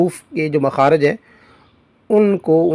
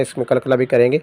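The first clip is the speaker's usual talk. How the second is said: ordinary talk, nothing marked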